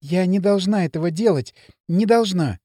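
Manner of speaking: plain reading voice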